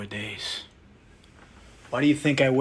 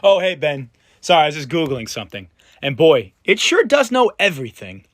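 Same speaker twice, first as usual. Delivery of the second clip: much louder, bright mock-casual; fake-cheerful greeting; 3 semitones higher, 11 dB louder than usual. Interrogator fakes a breezy hello to Ben, loud and bright.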